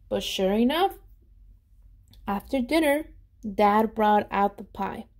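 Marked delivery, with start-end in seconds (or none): none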